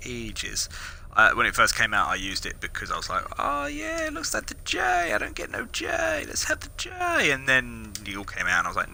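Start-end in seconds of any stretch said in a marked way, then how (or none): 3.4-7.3 very high-pitched excited squeal; put-on high excited voice; mock excitement. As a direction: squeal it like an overeager fan. Mimics own earlier hyped reaction.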